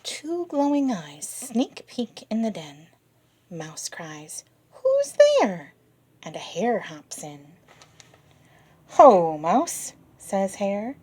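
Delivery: lively read-aloud, rising and falling; children's story reading. Acted. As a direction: read to the kids with wonder. Reading picture book to kids.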